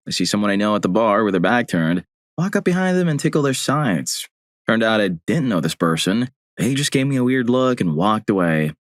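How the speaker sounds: ordinary delivery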